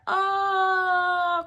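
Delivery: long, high, loud "uh"; imitated labor wail. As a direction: wail one drawn-out sound like a woman in labor. Client in labor in bathroom.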